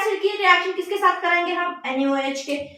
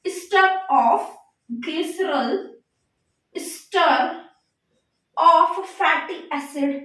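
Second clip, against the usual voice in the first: slow, word-by-word dictation; spaced out with long pauses for note-takers; much slower than usual. Real, not acted. Teacher dictates a compound's name to students, slow dictation.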